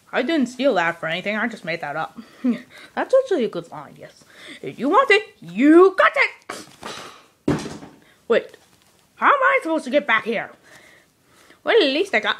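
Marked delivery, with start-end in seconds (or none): 4.6-6.7 leprechaun voice. Acted. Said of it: "You sound like a little leprechaun".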